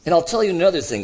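fast, high, loud retort; indignant outburst. A narrator's reading. Ghost rounding on the other, indignant. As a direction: round on him angrily.

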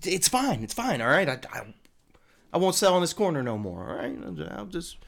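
high, flustered backpedaling voice; nervous dealer. Acted. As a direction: scramble to calm him down. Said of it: "Guy's like".

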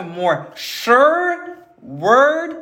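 "sure" and "word" stretched long, each set apart; drawn-out emphasis. Real, not acted. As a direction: preach it, dwelling slowly on "sure word". Preacher stresses key verse words.

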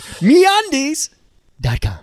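mock ad read swooping way up on MeUndies; Bill Burr's MeUndies read. Imitating a podcast host's ad read to his cohost. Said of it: "he used to do something funny with meundies where he'd go".

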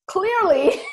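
high laugh breaking in. Real, not acted. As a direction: laugh at how it turned out. Laughing at own success.

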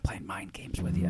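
sly whispered tease. Acted. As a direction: breathe it like a secret. GM teasing players in whisper.

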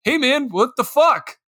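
slow, high, aggrieved protest; indignant buddy. Retold or imitated. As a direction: complain like your drink got tossed. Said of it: "your buddy who just watched".

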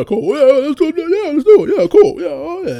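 hammed-up eager chorus; eager yes-men. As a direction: squeal yes like a pushover bandmate. Mimicking eager group members.

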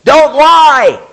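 high, very loud booming shout; God yelling from a mountain. Acted. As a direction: bellow it down from the mountaintop. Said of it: "God yelled from a mountain".

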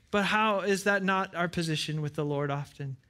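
ordinary delivery

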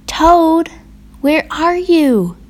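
high, drawn-out searching call. A narrator's reading. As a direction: call through the house, pitched high. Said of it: "called Frog".

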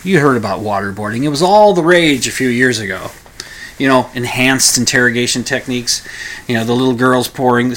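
ordinary talk

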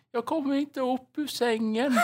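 high, slow, faraway cry from bed; an elderly neighbor who can't get up; old woman's cry. Retold or imitated. The old neighbor calling that she's stuck in bed.

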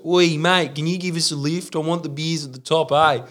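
high-pitched cheeky Aussie bloke; imitated bloke, pitched right up; bottle-o customer. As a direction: put on a pushy bloke asking a favour. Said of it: "This guy walks up and goes".

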